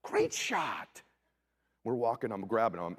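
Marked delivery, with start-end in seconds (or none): 0.0-1.0 high praise. Retold or imitated. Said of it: "I go".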